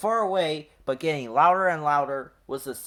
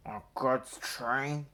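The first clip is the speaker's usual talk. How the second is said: grumbling; 4 dB quieter, slower than usual. A narrator's reading. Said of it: "he grumbled".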